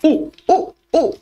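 high, short ape hooting; playful high gorilla calls; gorilla hoots. Acted. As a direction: hoot like a gorilla. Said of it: "Como los gorilas".

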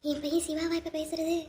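soft, slow aside to oneself; barely voiced amazement; hushed disbelief. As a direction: wonder quietly, drawing the words out. Stunned mother wondering to herself.

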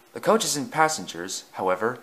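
plain reading voice

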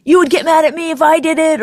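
hammed-up whiny partner throwing it back; mocking partner. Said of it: "and then you go".

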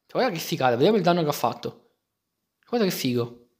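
ordinary talk

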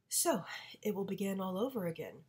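whispered bitter resignation. Acted. Mother whispering bitterly to father, resigned. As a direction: breathe it out in a whisper.

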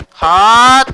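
short loud effort shout as he strikes; sharp battle yell. Real, not acted. Playing a fighting game with dad, a loud effort yell. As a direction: bark it out like landing a hit.

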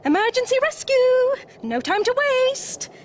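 high, loud little hero voice; high-pitched, flustered urgency; urgent Mole. A narrator's reading. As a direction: announce an emergency in a rush. Mole urgently rushes to the rescue.

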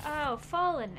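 ordinary talk

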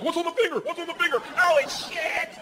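high-pitched mock panic; acted-out alarm. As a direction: cry out in rising alarm, hammed up. Acting out shouted dog trick reaction.

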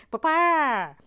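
loud, high squawking chicken voice; botched accent turned chicken, shouted high; Boston Nancy. Acted. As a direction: cluck and squawk the words like a hen. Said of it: "it appears I'm a chicken".

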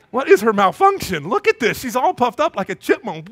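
loud, high comic indignation; exasperated rant. Retold or imitated. Husband complaining to wife about their daughter, mock exasperated. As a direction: play a baffled dad protesting.

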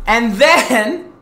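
ordinary talk

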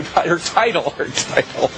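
giggling correction; anchor stifling giggles. Real, not acted. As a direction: fix the word while fighting laughter. Corrected through laughter.

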